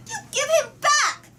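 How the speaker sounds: high, loud cry of outrage; furious child shout. A narrator's reading. Annie shouts at the queen for her puppy, furious. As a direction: scream it at her mother.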